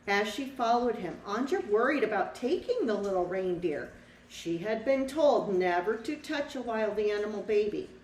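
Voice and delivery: lively storybook read-aloud for a class; reading to children. A narrator's reading. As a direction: tell the story to the children, bright and clear. Teacher reads picture book aloud.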